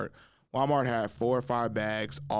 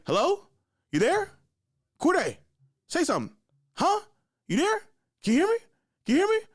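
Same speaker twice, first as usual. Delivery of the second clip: high, loud, insistent questioning; mother calling on phone; 5 dB louder, faster than usual. Retold or imitated. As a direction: call into a silent phone, short and high. Mother on phone, getting no answer.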